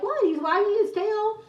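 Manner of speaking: high-pitched mock innocence; feigned innocence. Sisters deflecting mom's complaint, mock innocent. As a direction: play dumb, voice pitched right up.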